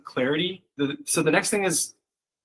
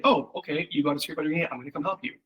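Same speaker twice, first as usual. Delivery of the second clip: softer, fading gentle reassurance; reassuring mom; 2 semitones higher, 4 dB quieter, faster than usual. Retold or imitated. Mom soothing her daughter with a scraped knee, soft and reassuring. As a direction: comfort the kid, getting quieter.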